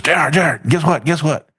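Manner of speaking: high-pitched, eager imitation of an excited friend; excited caller. Friend phoning with big news, all excited. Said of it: "he called me up one time all excited".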